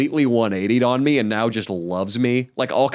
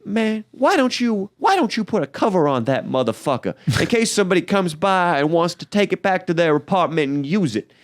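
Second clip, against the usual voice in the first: imitating the neighbor; 6 semitones higher than usual. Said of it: "She's like".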